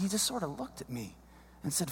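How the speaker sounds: usual speaking voice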